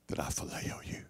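a broken, grieving whisper; whispering "that I phileo you" with quiet grief; grieved whisper. A narrator's reading. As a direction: barely breathe the words "that I phileo you" in sorrow. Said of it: "being grieved".

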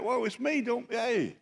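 high, soft voice; gently hushing frightened friends, pitched high; soft, high-pitched mock reassurance; Jesus reassuring the disciples. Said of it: "Jesus is like".

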